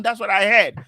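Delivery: usual speaking voice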